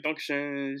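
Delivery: German thanks at ordinary pitch; attempted German accent. Passenger leaving plane, plainer German-accented thanks. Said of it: "that probably wasn't my best German tone".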